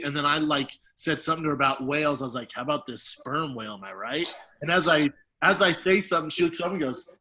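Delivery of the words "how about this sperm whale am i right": mock smooth pickup line. Smooth line to his crush.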